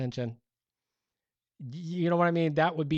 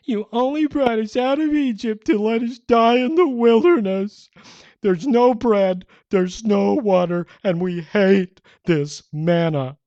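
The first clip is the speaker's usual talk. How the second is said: much higher, much louder whine, slow at the end; 9 semitones higher, 14 dB louder than usual. Acted. Israelites complaining to Moses in a loud high whine. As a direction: whine like spoiled brats. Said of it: "I gotta read this with a whine".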